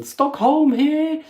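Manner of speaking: high, slow Eurovision host call; Eurovision announcer. Pretend. To his viewers, a mock Eurovision results call. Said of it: "I was just channeling, uh, Eurovision Song Contest".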